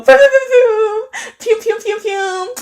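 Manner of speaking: very high squeaky laser sounds; playful pew-pew noises. Pretend. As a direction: fire off high-pitched celebratory pews. Imitated noises greeting a viewer.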